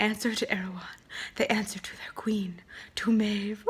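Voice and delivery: hushed, breathy whispered secret; whispering. Retold or imitated. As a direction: whisper it like a dark reveal. Reader whispers ominous quote.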